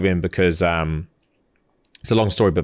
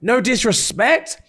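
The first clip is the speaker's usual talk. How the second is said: slow, even higher patronising repeat; condescending; 12 semitones higher, slower than usual. Retold or imitated. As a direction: drag it out, talking down. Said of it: "and he's like".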